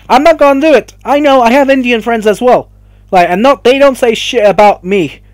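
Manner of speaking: furious vent about Indian critics; angry outburst. Real, not acted. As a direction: shout it out in frustration.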